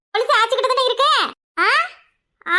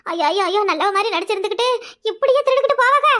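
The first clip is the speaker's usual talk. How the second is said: normal talking voice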